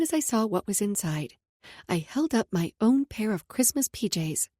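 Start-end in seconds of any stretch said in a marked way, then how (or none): none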